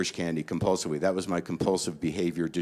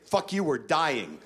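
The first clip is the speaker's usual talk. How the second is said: higher, slow, hammed-up doom; panicked snap; 7 semitones higher, slower than usual. Comic snapping at his calm inner voice, mock panic. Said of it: "And I say".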